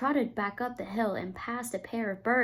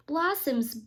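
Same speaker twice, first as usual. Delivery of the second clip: usual speaking voice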